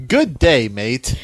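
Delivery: mock Aussie greeting, dropping on the last word; attempted Australian accent. Shown as a demonstration. Co-host tries out the requested voice, mock Australian accent. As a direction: try a hammy Australian accent. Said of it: "in an Australian accent".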